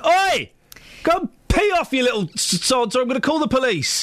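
put-on high, yelled threat at stone-throwing kids; mock shouting. Host yelling at kids throwing stones, shouted high. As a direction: bark it at the kids. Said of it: "I said".

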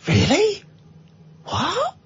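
high, quiet disbelief; mock surprise. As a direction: squeak out in mock shock.

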